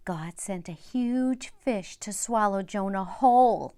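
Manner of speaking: ordinary delivery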